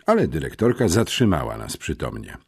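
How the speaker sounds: plain reading voice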